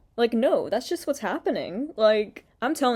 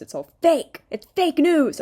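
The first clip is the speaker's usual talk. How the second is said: higher, louder mocking imitation; mock Trump; 8 semitones higher, 6 dB louder than usual. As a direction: ham up a Trump-style denial. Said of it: "she's like".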